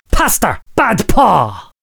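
usual speaking voice

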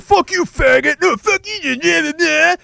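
hammed-up dumb tough-guy taunting; mock street brawler. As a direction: egg him on like a drunk idiot in a fight. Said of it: "Now we have guys who are like".

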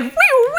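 very high whooshing "whew"; squeaky, loud spinning sound; whizzing noise. Pretend. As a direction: mimic zooming round a pole. Imitates whizzing round the pole.

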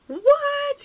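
high, loud shocked gasp; shocked what. Real, not acted. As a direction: blurt it out in shock. Shock at vagrancy law.

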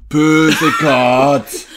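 loud, slow cooing call to the cats; loud drawn-out cat call, imitating a friend; Pernilla calling cats. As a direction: draw it out like calling pets, laughing at the end. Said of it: "starts going".

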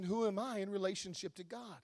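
ordinary talk

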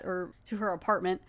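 ordinary delivery

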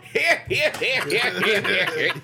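put-on old prospector calling out. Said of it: "he's gonna go over there going".

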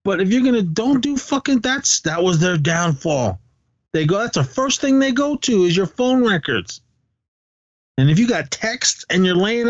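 worked-up rant about phone evidence; soapbox rant. Real, not acted. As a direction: get fired up and preach it. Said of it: "now I'm on my soapbox".

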